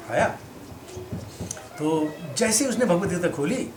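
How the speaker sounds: ordinary talk, nothing marked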